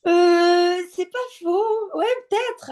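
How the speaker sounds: long drawn-out euh, then high-pitched pondering; hesitant musing. Real, not acted. Guest weighing the host's surprising question, hesitant musing. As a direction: answer high and loud on the euh, easing off.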